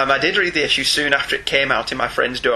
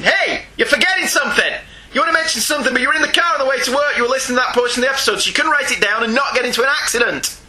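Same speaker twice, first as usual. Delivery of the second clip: high, insistent mock nagging; nagging inner voice; 8 semitones higher, faster than usual. Email reader voicing the writer's nagging feeling, high mock nagging. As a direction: nag it out as a high, urgent reminder. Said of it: "nagging feeling that was screaming at me".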